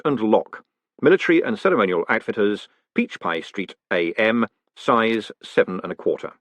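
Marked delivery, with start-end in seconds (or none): none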